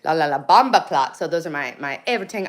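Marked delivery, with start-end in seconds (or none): 0.0-1.2 playful imitation Jamaican accent; mock Jamaican patois. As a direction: put on a Jamaican lilt for fun. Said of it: "a Jamaican version".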